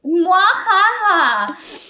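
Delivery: high, slow villain cackle; drawn-out hammed-up "mwahaha"; mock evil laugh. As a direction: fake cartoon-villain laughter.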